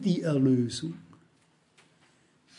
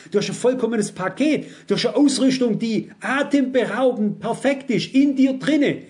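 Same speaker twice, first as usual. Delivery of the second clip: preaching climax; 6 semitones higher than usual. Acted. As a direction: preach it out with mounting fire. (sich steigernde, laute Predigt)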